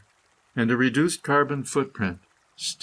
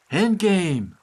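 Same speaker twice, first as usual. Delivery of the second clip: emphatic proclamation; 7 semitones higher, 4 dB louder than usual. Acted. Dramatic cry of key word.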